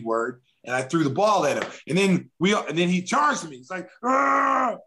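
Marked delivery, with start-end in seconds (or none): 4.0-4.8 higher, short hammed-up roar; retelling the brawl with a mock growl; mock battle roar. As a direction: let out a mock yell as he charges. Retold charge at him.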